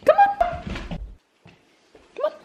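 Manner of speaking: very high coaxing call. Real, not acted. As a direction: coax the puppy in a squeak. Coaxing puppy.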